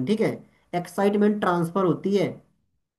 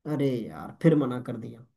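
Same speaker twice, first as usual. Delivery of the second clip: mock disappointment; 5 semitones lower, 5 dB quieter than usual. Caller disappointed after customer refuses.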